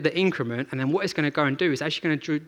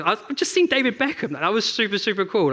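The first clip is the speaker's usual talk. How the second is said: much higher, starstruck squeal of a fanboy; giddy fanboy; 6 semitones higher than usual. Retold or imitated. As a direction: gush like a giddy little fanboy. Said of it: "the most giddy little fanboy".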